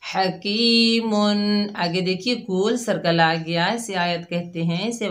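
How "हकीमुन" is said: हकीमुन ending in a long nasal hum, very slow; ghunna demo. Shown as a demonstration. Teacher showing students ghunna on tanween, slow recitation. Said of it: "तनवीन में गुन्ना करेंगे".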